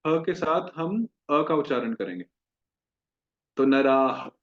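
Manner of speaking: ordinary delivery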